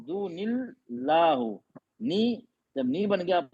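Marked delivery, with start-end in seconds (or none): 0.0-1.6 दूनिल्लाहु stretched out slowly; slow recitation demo. Shown as a demonstration. Teacher recites slowly for students to follow. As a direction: draw out दूनिल्लाहु syllable by syllable. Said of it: "teacher demonstrates recitation".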